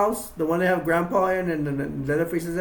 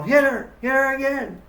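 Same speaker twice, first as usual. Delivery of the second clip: urging; 8 semitones higher, 4 dB louder than usual. Retold or imitated. Family eggs Grandpa on.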